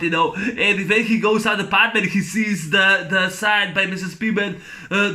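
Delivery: ordinary talk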